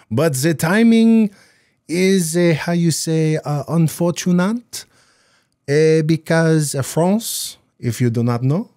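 jokey fake French accent; mock French accent. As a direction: put on a cartoon French accent. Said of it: "get my French accent back".

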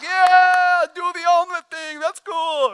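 high, loud cheering fan; cheering crowd. Retold or imitated. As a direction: hype him up like an eager spectator. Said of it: "people are like".

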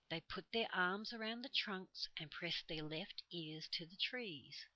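usual speaking voice

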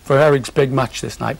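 ordinary talk, nothing marked

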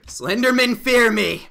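slow, loud mock-menacing boast; mock threat. Real, not acted. Player taunting Slenderman, hammed-up threat. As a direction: growl it out like a hammed-up villain. Boasting after getting sword.

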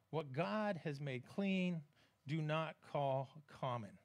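solemn divine reply, unhurried; slow solemn voice of God. Acted. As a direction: let each word land slowly. God's voice slow reading.

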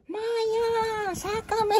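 voz muy aguda pidiendo auxilio desde dentro; voz de Paqui en la caja. Pretend. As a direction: chillido agudo de alguien atrapado. (voz aguda de Paqui atrapada en la caja)

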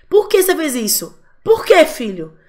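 impatient parent scolding. Retold or imitated. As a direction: press the child hard. Parent demanding explanation again.

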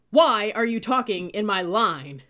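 very loud, slow, high-pitched roar; explode, stretching out each word; explosive yell. A narrator's reading. Cadet catching girls chatting in line, roaring.

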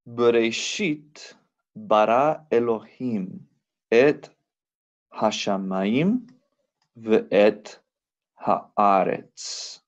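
Bereshit bara Elohim read out word by word, slowly; slow careful reading. Shown as a demonstration. Teacher reading Genesis aloud to students, slowly. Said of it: "vamos a leer esto juntos".

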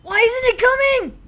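high, anxious wail; panicked frustration. Real, not acted. Gamer stuck waiting for it to come, panicked cry. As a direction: cry it out, desperate at the wait.

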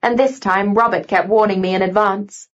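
usual speaking voice

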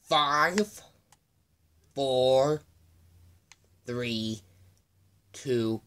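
loud, spaced-out count; play-acted execution countdown. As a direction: count down loudly, starting high and dropping.

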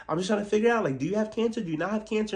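high mock question; pry in a put-on sweet voice.

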